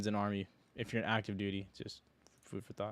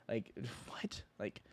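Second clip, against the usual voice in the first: whispered disbelief; 13 dB quieter, slower than usual. Real, not acted. Stunned reaction to the quote.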